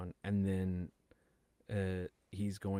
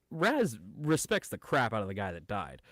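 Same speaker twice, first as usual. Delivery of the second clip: ordinary delivery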